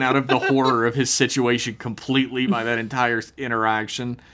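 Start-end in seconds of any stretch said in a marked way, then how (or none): none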